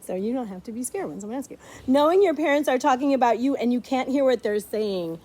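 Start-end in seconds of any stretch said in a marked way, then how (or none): none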